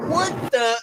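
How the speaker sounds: high startled yell. Acted. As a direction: yell it in sudden surprise. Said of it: "he yelled".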